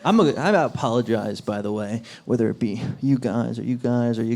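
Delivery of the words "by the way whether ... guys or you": ordinary delivery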